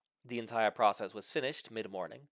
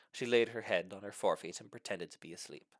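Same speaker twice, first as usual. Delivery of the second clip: plain reading voice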